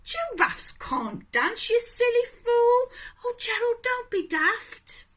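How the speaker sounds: sing-song schoolyard taunt; taunting animals. Acted. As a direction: jeer at Gerald like bullies. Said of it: "the animals all laughed".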